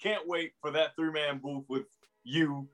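ordinary talk, nothing marked

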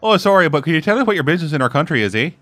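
quick, polite mock-Canadian; apologetic Canadian accent. Border local questioning a visitor, apologetic mock Canadian accent. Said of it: "if that's not Canada".

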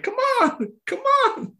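put-on high, raspy old man coaxing; Herbert voice. Old patient at the urinal coaxing himself to pee, raspy high old man voice. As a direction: plead with it like a cranky old man.

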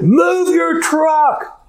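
high, drawn-out mock yell; retold yelling. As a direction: yell it slowly like the irate driver being imitated. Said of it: "She starts yelling at him".

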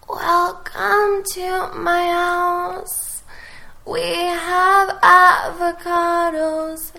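slow, high, airy and breathy; breathy indie voice. Shown as a demonstration. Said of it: "give us one more go with more breath".

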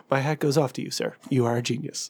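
low, softened game-show host; Alex Trebek. Acted. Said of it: "Trebek would be like".

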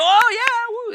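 high, loud mock whoop from the crowd; crowd cheering. Preacher imitating a comedy crowd cheering for Tennessee, high excited whoop. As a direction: cheer like a hometown fan. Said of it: "would go".

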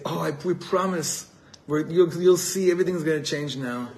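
a guilty student swearing he'll change; pleading student. Retold or imitated.

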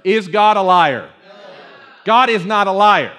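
full-voiced, emphatic question to the room; rousing call-out. Shown as a demonstration. Preacher asks everybody to respond, rousing and full-voiced. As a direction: put it strongly so everybody answers. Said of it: "let me hear everybody".

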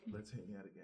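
very soft, offhand; understated imitation. Contrasting Bully with the blaring nominees, hushed and offhand. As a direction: drop right down to a tiny murmur. Mimicking a low-key film, after loud ones.

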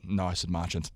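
low, soft embarrassed correction; embarrassed quiet reply; sheepish mumble. Retold or imitated. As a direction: mutter it with your head down.

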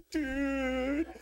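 high drawn-out rising dude from the shark; friendly shark dude. Acted. Shark greets them.

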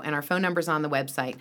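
ordinary delivery

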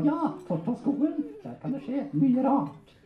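high, cheeky ventriloquist puppet voice; puppet character. Acted. Puppet correcting its handler, high comic voice. Puppet takes over the talk.

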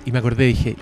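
usual speaking voice

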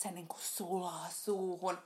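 slow, soft mock rapture; softly savoured, teasing quote; imitated gushing. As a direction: draw it out slowly as someone else's raving. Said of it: "mut kun Ritva oli tässä hehkuttanut".

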